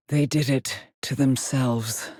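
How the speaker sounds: ordinary talk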